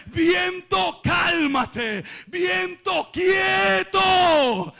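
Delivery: high, shouted command; ringing cries of command; commanding the wind. Acted. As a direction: order the storm to be still. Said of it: "da una orden al viento".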